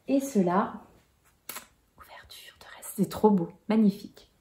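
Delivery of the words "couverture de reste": soft, breathy whisper as if telling a secret; chuchoté, pour soi-même. Real, not acted. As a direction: hush it like an aside meant only for herself. Whispering an aside about the leftovers.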